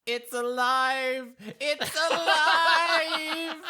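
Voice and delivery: high, slow triumphant shout, laughing into it; hammed-up mad scientist exclaiming; mad scientist cry. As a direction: crow it like Frankenstein. Player quotes Frankenstein.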